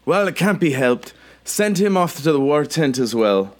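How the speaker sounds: high-pitched female commander voice; woman officer. Acted. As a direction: give orders as a woman in charge. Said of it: "And she says".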